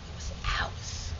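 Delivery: whispered, soft and slow. Shown as a demonstration. Claiming Dewey Avenue, whispered. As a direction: let "It was ours." fall to a breathless whisper. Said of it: "Dewey Avenue was ours".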